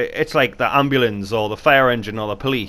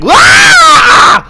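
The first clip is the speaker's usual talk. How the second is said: sudden high shout; mock scare yell; 17 dB louder than usual. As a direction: jump out and yell it back. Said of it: "You ever just be like".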